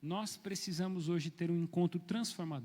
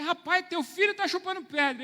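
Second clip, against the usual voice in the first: alarmed call; 11 semitones higher, 9 dB louder than usual. Retold or imitated. Retold alarm at child.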